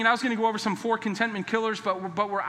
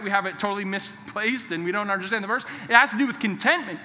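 usual speaking voice

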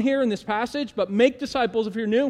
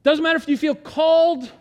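loud, slow, higher proclamation; urgent exhortation; 6 semitones higher, 6 dB louder, slower than usual. Acted. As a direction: drive each word home.

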